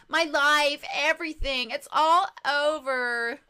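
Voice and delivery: loud, drawn-out despair; wailing scream. Acted. Client crying out that life is over, wailing. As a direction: wail it, dragging the last words out. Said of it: "your client screaming over there".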